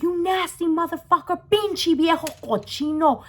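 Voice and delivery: high-pitched outraged cursing; going off. Retold or imitated. Teller cursing out the man, going off. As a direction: hurl insults in acted disgust. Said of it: "I'm going off on this motherfucker".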